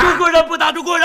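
high, loud, fast cry; shouted slogan. Acted. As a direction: fire the slogan off hard. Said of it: "喊呐".

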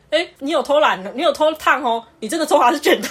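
accusing teacher. Retold or imitated. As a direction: catch the student out, half teasing. (老师抓到学生烫头发，大声质问)

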